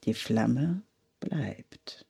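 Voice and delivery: quiet, slow, whispered. A narrator's reading. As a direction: let it settle in a soft whisper. Calm flame against the wind. (langsam geflüstert)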